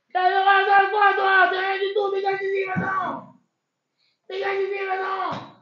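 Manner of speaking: high, loud contemptuous shouting; angry dismissal. Acted. Landlord yelling at the son-in-law to get out, furious. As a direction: throw him out with contempt.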